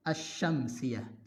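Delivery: asyamsiyah very slow, no lam, doubled sy; lam not read. Shown as a demonstration. Teacher models asyamsiyah for students, very slow. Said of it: "Cara membacanya seperti membaca asyamsiyah".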